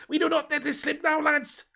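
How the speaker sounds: high-pitched mock Scouse rallying cry; Scouse accent. Streamer redoing Gerrard's team talk, in exaggerated Scouse. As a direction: pitch it right up in put-on Scouse. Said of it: "Let's get Scouse".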